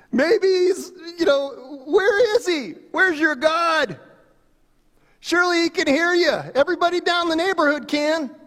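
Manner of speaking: mock them like a smack-talking fan; mocking taunt. Elijah asks the prophets where their god is, high mocking jeer. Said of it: "Elijah began to taunt them".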